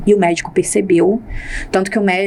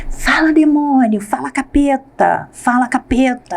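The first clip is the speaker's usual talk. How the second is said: slow, higher-pitched exorcist cry, only lightly voiced; mock exorcist rant; 5 semitones higher, slower than usual. Husband ranting at his wife as if exorcising her, slow and higher. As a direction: drag it out like a preacher casting out a demon.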